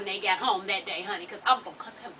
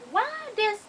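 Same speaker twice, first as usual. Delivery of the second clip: indignant disbelief; 10 semitones higher than usual. Real, not acted. Indignant rhetorical question.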